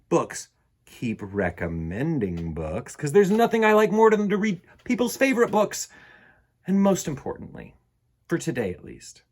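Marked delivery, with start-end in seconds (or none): none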